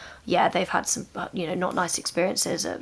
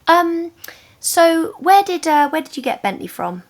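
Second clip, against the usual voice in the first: imitating the student; 10 semitones higher, 6 dB louder than usual. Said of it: "she said".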